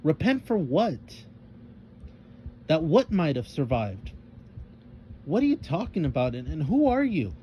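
pushy, demanding questions; impatient. A narrator's reading. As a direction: press him for answers, fed up. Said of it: "she spoke with impatience".

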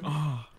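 soft mock moan. Teasing a friend by imitating his moan, soft. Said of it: "but you went".

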